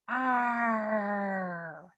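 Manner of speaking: long drawn-out "arrr" growl. Shown as a demonstration. Speech coach warming up tongue and soft palate, growling. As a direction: hold a growled R, sliding gently down. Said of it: "soft palate by growling".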